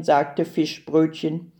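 plain reading voice

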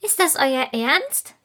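delighted, piping question; a tiny fairy who can hardly believe it; joyful fairy voice. A narrator's reading. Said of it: "fragt Lilia freudig".

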